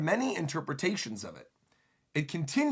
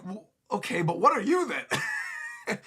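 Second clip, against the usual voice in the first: puzzled question; 6 semitones higher, slower than usual. Acted. Crew questioning Brook.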